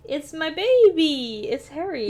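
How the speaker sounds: excited. Real, not acted. Gushing over pet hamster.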